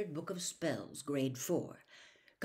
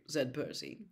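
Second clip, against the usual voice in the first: ordinary delivery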